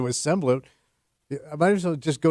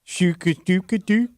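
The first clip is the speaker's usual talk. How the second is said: Swedish-ish gibberish; 4 semitones higher, slower than usual. Pretend. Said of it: "See, you're getting it".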